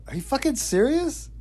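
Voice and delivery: can't believe what you're hearing; incredulous. Retold or imitated. Incredulous reply in story.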